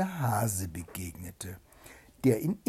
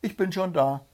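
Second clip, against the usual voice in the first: high, lightly raised call; demonstrated call; 7 semitones higher, 4 dB louder than usual. A narrator's reading. Hedgehog rehearsing the call with his wife, restrained call. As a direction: show her how to call it, held back. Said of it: "so rufst du ihm entgegen".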